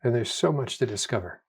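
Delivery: soft, easy and quiet; relaxed mind's gentle thought; calm inner voice. Retold or imitated. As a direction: murmur it like a contented mind. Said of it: "the unstressed thinking mode is".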